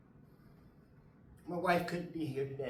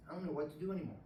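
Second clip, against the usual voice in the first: usual speaking voice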